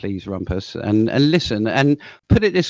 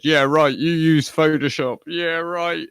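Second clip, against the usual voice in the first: higher sneering imitation of his scoffing; mocking sarcastic quote; 5 semitones higher, slower than usual. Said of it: "Because he said".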